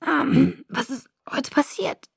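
high, soft, strained questioning; weak hoarse voice. A narrator's reading. Bella asking Jacob what happened today, weak strained voice. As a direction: ask it carefully through a sore throat. Sore-throated girl questions her rescuer.

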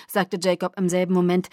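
ordinary delivery

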